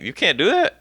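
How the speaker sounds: high, puzzled mock question; retold disbelief. Acted disbelief of new district.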